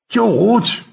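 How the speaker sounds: a sharp high call of the name. Acted. Stanley calling up to George, loud call. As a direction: call George from far below.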